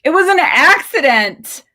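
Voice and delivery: high, loud cry of horror; horrified cry. Acted. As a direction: cry out in panicked apology. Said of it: "cried Lindsay, trembling with horror".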